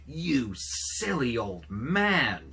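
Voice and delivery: loud, slow, contemptuous wife; angry scolding. A narrator's reading. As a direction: spit it out at the foolish husband. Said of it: "she screamed".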